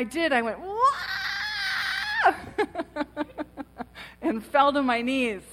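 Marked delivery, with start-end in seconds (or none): 0.6-2.3 long, very high wailing scream; retold scream. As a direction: let out one long, high, loud mock scream. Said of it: "And I screamed".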